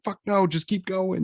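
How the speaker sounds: strained yell. Retold or imitated. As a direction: yell it through the pain. Retold yelling in pain.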